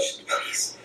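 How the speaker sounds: soft breathy begging whisper; whispered please. Real, not acted. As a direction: whisper the plea, almost praying. Whispered plea.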